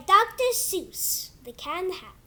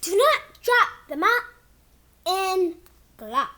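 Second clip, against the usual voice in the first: put-on reading to kids; 3 dB louder than usual. Kid reading his book to viewers in storybook voice. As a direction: read it like a bedtime book for little ones. Reads picture book aloud.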